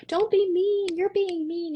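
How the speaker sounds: put-on high, loud complaining child; whiny kid. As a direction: protest like an upset little kid. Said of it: "our kids are like".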